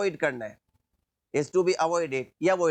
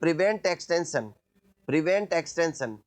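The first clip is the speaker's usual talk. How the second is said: slow dictation; much slower than usual. Shown as a demonstration. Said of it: "extension को रोकना है".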